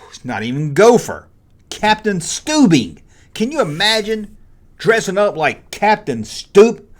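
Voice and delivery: ordinary talk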